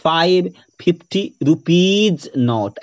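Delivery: slow, word-by-word example phrase; demonstrated mistake. Shown as a demonstration. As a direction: spell out the mistake slowly, piece by piece. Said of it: "ये गलती नहीं कर बैठिएगा".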